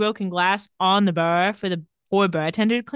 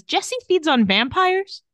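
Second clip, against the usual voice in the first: high, drawn-out incredulous question; acted disbelief; 9 semitones higher, slower than usual. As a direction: retell it as a startled question. Acts Elena's startled question on phone.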